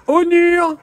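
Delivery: high, drawn-out call; calling the dog. Real, not acted. Calls the runaway dog.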